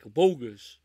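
loud, punchy movie-line bark; mock movie outburst; quoted catchphrase. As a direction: shout the catchphrase like the film.

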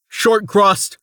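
high, loud shout. Acted. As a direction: call out to the sentry. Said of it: "Rain shouted".

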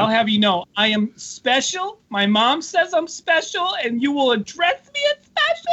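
slow, drawn-out mock offence, climbing in pitch; mock indignation. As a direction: pretend to be deeply offended, stretching each phrase. Mock offended at derogatory term.

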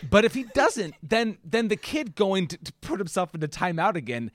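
usual speaking voice

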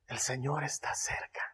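unvoiced whisper. Shown as a demonstration. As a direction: whisper it close.

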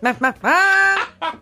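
slow high bleating laugh; mock summer laugh. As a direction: bleat it out slowly like a goofy laugh. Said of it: "Your summer laugh is that".

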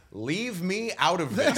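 high, slow, laughing leave me out; playful plea. Retold or imitated. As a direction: beg off lightly with a laugh. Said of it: "it breaks the tension".

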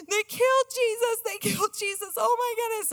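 put-on high, wailing little boy near tears; imitating a crying child. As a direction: wail it like a heartbroken five-year-old. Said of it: "he starts saying".

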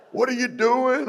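high-pitched retold question; surprised question. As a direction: voice someone high and startled.

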